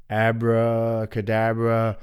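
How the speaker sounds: slow mystical abracadabra; magician's incantation. Acted. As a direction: draw it out like a magician. Wolf doing magic.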